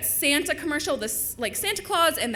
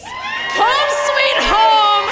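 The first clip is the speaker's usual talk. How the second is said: loud, high, ringing declaration; triumphant shout; 5 semitones higher, 10 dB louder, slower than usual. Acted. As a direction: proclaim it to the whole room, drawing out key words. Triumphant announcement closing the act.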